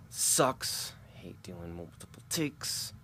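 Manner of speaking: quiet, breathy grumble to himself; muttered aside. Real, not acted. Annoyed at redoing the take, muttering quietly. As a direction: mumble it under your breath, annoyed.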